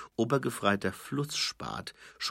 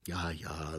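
softer, calming yes-yes; calm down a heated friend; Nobby soothing an angry Fred; 2 semitones lower, 6 dB quieter than usual. Acted. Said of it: "mit beschwichtigenden Gesten".

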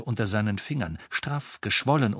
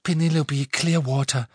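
higher voice, a touch of fear; frightened but convincing; 4 semitones higher, 4 dB louder than usual. A narrator's reading. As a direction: lie convincingly despite the fear. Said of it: "Sie klang verängstigt, aber überzeugend".

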